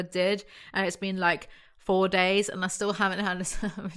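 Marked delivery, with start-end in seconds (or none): none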